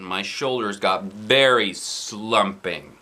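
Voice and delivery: plain reading voice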